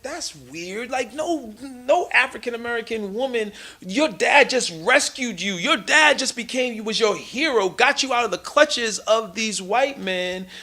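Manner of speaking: rising disbelief at the hug; retold indignation. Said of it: "And I was like".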